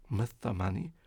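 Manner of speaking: usual speaking voice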